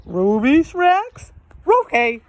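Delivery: high, slow eager dog-speak; Scooby-Doo voice. Acted. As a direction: perk up at the treat like Scooby.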